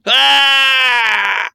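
dying scream cut off abruptly; high loud scream that suddenly stops; death cry. A narrator's reading. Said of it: "Его крик оборвался моментально".

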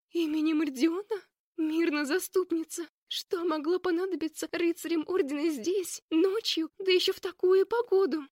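rising alarm at night visitors; alarmed exclamation. Acted. Leya alarmed by the knights.

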